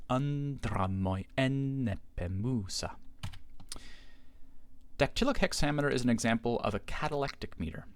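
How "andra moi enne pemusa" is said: slow recitation of "Andra moi enne pemusa" with a short moi; scanning the verse. Shown as a demonstration. Teacher recites the line again to show short moi, slow. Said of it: "pronounced as a short".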